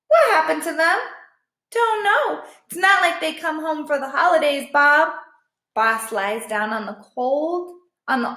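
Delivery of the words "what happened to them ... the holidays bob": higher shrugging stray; lightly voiced resigned dog; a hint of a high dog voice; dog dialogue. A narrator's reading.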